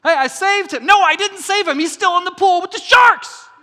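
preacher hamming up the useless rescuer, loud and high; mock outrage. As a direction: belt it out, fake indignant. Mocking self-satisfied rescuer.

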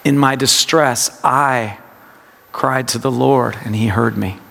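I held, then a pause; low meditative reading, weight on I; stress on I. Shown as a demonstration. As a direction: set "I" apart before reading on. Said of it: "it's a practice of word emphasis".

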